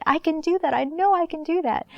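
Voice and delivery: put-on light childlike eagerness; eager little girl. Childhood self quoted in story.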